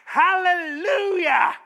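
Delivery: loud, high, drawn-out cry of praise; joyful shout. Acted. Barnabas sees Gentiles accepting Christ, joyful shout. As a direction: belt it out, overjoyed and slow. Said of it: "He is so happy about this".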